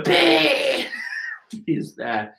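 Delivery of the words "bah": higher, sudden blurted bah; comic panel shout. Acted. The panel goes bah, a high blurted cry. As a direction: blurt the sound effect. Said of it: "we have the panel going".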